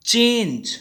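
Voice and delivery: "Change" said alone with firm stress; stressed word. Shown as a demonstration. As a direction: lean on "change" and let it fall. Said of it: "stress पड़ रहा है".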